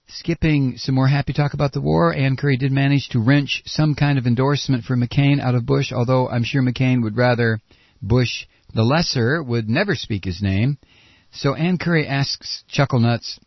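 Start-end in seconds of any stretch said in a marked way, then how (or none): none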